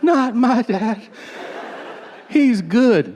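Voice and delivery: high mock-defensive voice; naive protest. Listener defends their dad, high mock protest. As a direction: protest high, imitating a loyal child.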